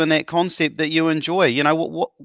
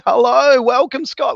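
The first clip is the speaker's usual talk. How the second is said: high, loud put-on man's greeting; Scott impersonation; 9 semitones higher, 4 dB louder than usual. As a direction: do a hammed-up Scott voice. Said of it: "my best, Scott impersonation".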